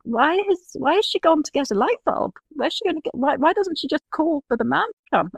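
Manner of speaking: high-pitched mock child whine, indignant questions; entitled six-year-old. Retelling the child's complaint about the light bulb, entitled high child voice. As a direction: put on a demanding little kid's high voice. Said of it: "your entitled little six-year-old says".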